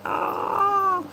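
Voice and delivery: a sudden high wail, acted out; high mock wail. Vlogger imitating the grieving Titan's high cry. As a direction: voice the monster's cry of loss, shrill and brief. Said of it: "they're like".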